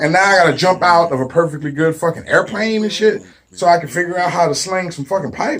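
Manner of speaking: ordinary talk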